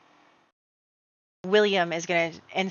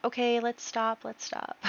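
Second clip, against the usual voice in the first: higher, soft, fading to a whisper; half-hearted peacekeeping; 5 semitones higher, 6 dB quieter than usual. Acted. William stepping into the fight, timid and hushed. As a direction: feebly try to break it up.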